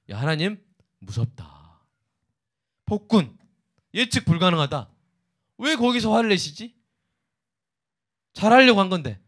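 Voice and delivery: David venting, fear turning into protest; David's frustrated voice. Retold or imitated. (두려움에서 억울함으로 치닫는 다윗의 목소리)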